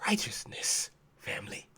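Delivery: breathy whispered "Righteousness". Acted. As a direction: drop to a hushed whisper. Whispered answer to question.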